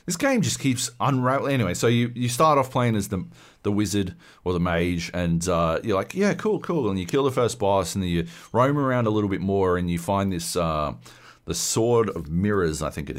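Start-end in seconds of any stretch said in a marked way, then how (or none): 6.1-6.9 high, casual "cool, cool"; breezy approval. Retold or imitated. New player pleased with the starting mage, high and breezy. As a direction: play the easily satisfied beginner. Player content with first character.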